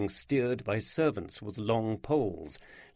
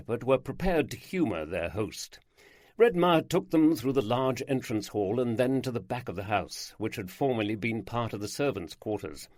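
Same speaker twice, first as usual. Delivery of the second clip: usual speaking voice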